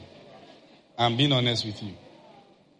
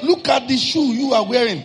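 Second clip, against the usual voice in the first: mock scolding; 8 semitones higher, 6 dB louder than usual. Preacher scolding his friend.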